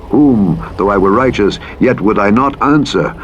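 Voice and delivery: ordinary delivery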